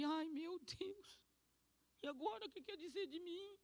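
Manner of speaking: tearful plea. Real, not acted. Retelling her tearful talk with God.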